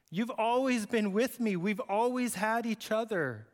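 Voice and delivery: put-on father urging the older son to understand; pleading father. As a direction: beg him to see it.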